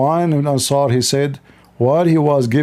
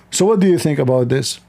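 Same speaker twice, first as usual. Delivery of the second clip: usual speaking voice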